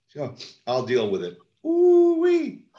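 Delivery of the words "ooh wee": ooh-wee. Real, not acted. Reacting to the dump smell.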